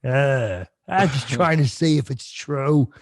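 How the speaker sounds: slow creepy uncle voice; drawn-out, leering character voice; Uncle Paul. Acted. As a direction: play the creep excusing himself. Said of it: "apparently Uncle Paul heard rumors".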